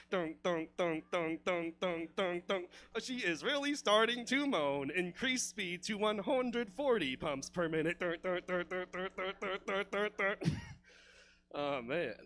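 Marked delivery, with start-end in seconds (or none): 0.1-2.7 evenly spaced mechanical thuds; imitated piston noise. 3.0-8.0 stiff machine status report, pitched up; mock robotic lover. 8.0-10.4 rapid, even mechanical pulses; imitated machine noise. Imitating faster mechanical pumping.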